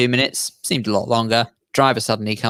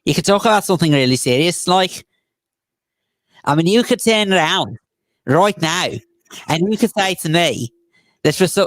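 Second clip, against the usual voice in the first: higher-pitched put-on Scouse; Liverpool accent; 5 semitones higher than usual. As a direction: exaggerate a lilting Scouse accent. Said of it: "Liverpoolsian accents".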